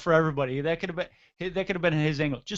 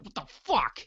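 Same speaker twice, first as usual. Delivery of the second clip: imitated startled blurt; 3 semitones higher than usual. Retelling the announcer grabbed by the neck, startled blurt. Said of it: "he just blurts out on Nitro".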